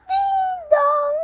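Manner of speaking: doorbell ding dong. Pretend. Doorbell imitated in play.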